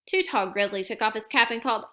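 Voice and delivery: ordinary delivery, nothing marked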